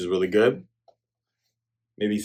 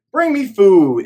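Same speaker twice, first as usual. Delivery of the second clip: cocky demand; 7 semitones higher, 7 dB louder, slower than usual. Retold or imitated. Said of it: "He's feeling cocky".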